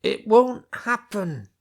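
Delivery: high, slow strangled shout. Acted. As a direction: force it out through a tight throat. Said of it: "in a strangled tone of voice".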